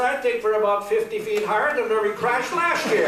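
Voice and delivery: high, loud comic punchline voice; crash survivor answering. Acted. Knut answering Ole from the wreckage, high mock call. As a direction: call back in a hammed-up high voice.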